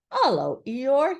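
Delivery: loud hail to an acquaintance; brisk Rabbit greeting. A narrator's reading. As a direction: call out a hello. Rabbit hails Eeyore.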